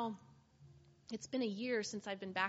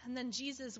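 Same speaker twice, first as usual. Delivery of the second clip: normal talking voice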